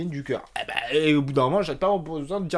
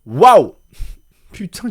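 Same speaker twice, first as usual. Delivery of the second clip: surprised; 5 semitones higher, 12 dB louder, much slower than usual. Real, not acted. Mock amazement at the story.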